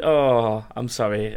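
usual speaking voice

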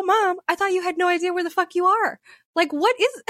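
high-pitched mock exasperation; retold incredulous retort. Daughter retelling her challenge to mom, incredulous and about to laugh. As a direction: call her out in amused disbelief.

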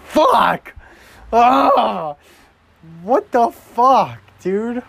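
high, loud frustrated yelling; angry shout. Real, not acted. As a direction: blow up at the play. Frustrated outburst at the game.

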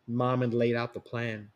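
ordinary delivery, nothing marked

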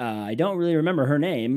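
normal talking voice

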